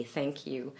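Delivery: softly said Thank you, voiceless th. Shown as a demonstration. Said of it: "do not demand your, a vibration".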